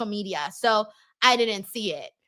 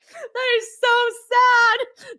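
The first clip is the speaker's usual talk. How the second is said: mock sad; 10 semitones higher, 5 dB louder, much slower than usual. Mock pity at a chat joke.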